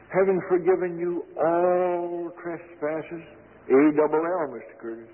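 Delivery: slow scripture reading with all leaned on; slow emphatic reading. Shown as a demonstration. Preacher reading to Curtis, slow and emphatic. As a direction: spell out A double L slowly. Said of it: "read it again to him slowly".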